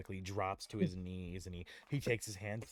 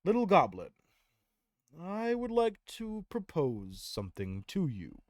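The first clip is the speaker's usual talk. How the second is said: higher, measured, formal character voice; Tyrael proposing; 9 semitones higher, 6 dB louder than usual. Acted. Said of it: "walks up to the goblin and he says".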